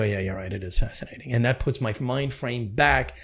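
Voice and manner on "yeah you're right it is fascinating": lower, softer settled voice; calmed down and agreeing; calm agreement. Retold or imitated. The speaker reassured by a partner, lower and softer. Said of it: "And I go".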